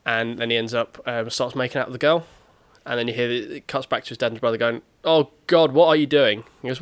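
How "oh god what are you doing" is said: raised, loud horrified protest; retold shocked exclamation; mock appalled. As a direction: act out catching someone in the act. Retold dad and brother catch him.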